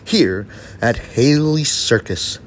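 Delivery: booming circus announcer, building up; ringmaster. Acted. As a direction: announce the act to the big top.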